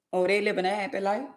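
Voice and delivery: ordinary talk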